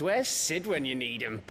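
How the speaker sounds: usual speaking voice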